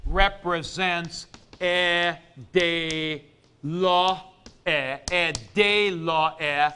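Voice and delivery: e, de, lo, e said slowly with pauses; a teacher splitting a word into syllables; syllables broken apart. Shown as a demonstration. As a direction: separate each syllable of e de lo e. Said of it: "like that".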